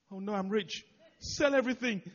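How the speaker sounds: a playful fake freak-out; mock panic. Pastor mocking his own misreading, in mock panic.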